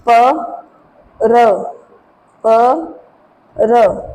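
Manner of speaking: pa, ra, pa, ra spelled out slowly one at a time; French syllables drilled. Shown as a demonstration. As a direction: sound out pa and ra as separate syllables. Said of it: "For example".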